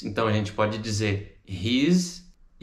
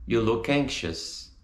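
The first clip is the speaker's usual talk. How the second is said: "You look anxious" flowing in one phrase; joined up. Shown as a demonstration. Teacher repeats for students, words joined up. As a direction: link "look" straight into "anxious".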